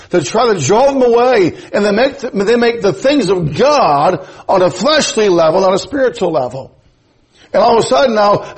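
high, emphatic pulpit delivery; preaching. Acted. Preacher warns the congregation about alluring false teachers, emphatic preaching. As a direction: preach it with rising, driving phrases.

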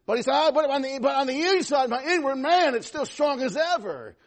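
forceful preaching. Real, not acted. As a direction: build to a loud, high proclamation.

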